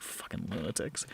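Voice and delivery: low, soft muttered aside; muttering. Acted. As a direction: mutter it under your breath. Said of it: "like, mutters something".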